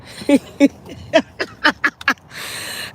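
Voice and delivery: long breathy giggle building into a cackle; cackling laugh. Real, not acted. Reacting to her friend's trash talk, cackling. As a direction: crack up helplessly.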